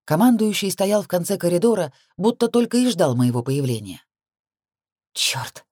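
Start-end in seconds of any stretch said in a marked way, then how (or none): 5.1-5.7 hushed, voiceless mutter; whispered curse. A narrator's reading. Heroine curses on spotting the commander, whispering. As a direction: swear under your breath.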